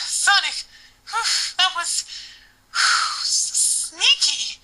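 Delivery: put-on high, panting girl voice; breathless Amy. Amy catching her breath after tickling, high and panting. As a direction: gasp the words out, still out of breath. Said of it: "Amy panned".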